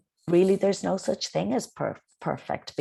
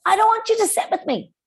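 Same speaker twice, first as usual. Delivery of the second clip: much higher and louder, fast and rude; a child snapping rudely at a classmate; 9 dB louder, faster than usual. Shown as a demonstration. Teacher demos a rude child refusing a classmate, high and loud. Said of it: "However, in a, in a polite tone of voice".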